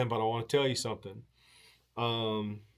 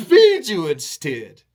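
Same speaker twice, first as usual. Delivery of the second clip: ordinary talk, nothing marked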